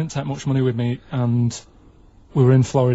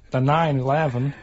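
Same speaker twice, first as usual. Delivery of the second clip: put-on "nine eleven" in a light American accent; a hint of an American accent, "nine eleven". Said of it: "Do that American accent again?".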